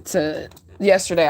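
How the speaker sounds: normal talking voice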